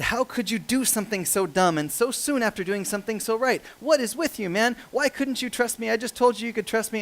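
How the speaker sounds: high-pitched exasperated telling-off; mock scolding. The Lord berates Isaac in a made-up verse, mock scolding. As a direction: pile up the reproaches in mock outrage. Invented scolding from God, as joke.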